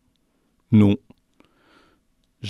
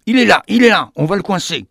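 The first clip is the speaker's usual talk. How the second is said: cri plus fort et plus aigu; le vigile crie pour coincer le rat; guard shouting; 6 semitones higher, 5 dB louder than usual. Acted. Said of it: "criait-il". (le vigile crie à son collègue)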